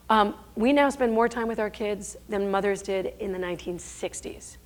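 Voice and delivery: ordinary talk, nothing marked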